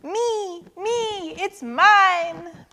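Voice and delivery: put-on high, stretched childish cries; screeched claim. A narrator's reading. Everyone claims it at once, screeching high. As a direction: squabble for it up high. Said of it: "screeched everyone".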